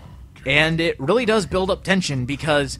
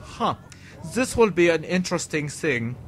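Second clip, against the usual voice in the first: Giger impression; 5 dB quieter than usual. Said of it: "be like".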